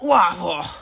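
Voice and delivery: loud, high fake wow; mock amazed dad. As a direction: ham up dad's amazement. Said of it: "he's gonna be like".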